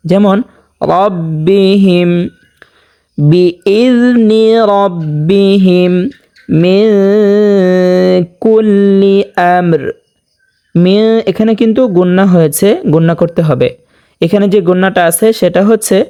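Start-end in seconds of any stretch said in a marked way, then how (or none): 0.8-9.8 রাব্বিহিম with a thick, full র; slow chanted রাব্বিহিম; heavy R recitation. Shown as a demonstration. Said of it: "মোটা করিয়া পড়ব".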